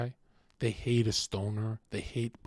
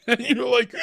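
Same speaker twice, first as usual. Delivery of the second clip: laughing; 14 semitones higher, 12 dB louder than usual. Real, not acted. Laughing mid-bit.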